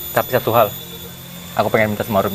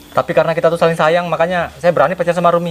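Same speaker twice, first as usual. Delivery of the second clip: higher-pitched insistent pleading; earnest protest; 7 semitones higher than usual. Acted. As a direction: defend your love to her angry father. Boyfriend pleads his case to her father. (membela diri dengan nada tinggi)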